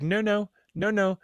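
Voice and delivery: high-pitched brush-off; mock deflection. Mimicking officials dodging questions, high mock denial. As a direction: wave them away in a high mock voice.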